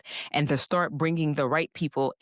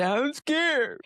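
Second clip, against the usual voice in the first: high, small mock-scared whimper; 10 semitones higher than usual. Preacher voicing a fearful listener, high mock-scared. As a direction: squeak it out like a nervous believer.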